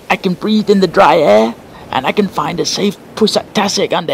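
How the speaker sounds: a tiny crab delighted with itself. Acted. Little crab boasting of its new gifts, tiny high proud voice.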